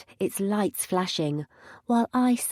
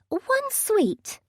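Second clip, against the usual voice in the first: chirpy receptionist voice. A narrator's reading. Mercy corrects brightly.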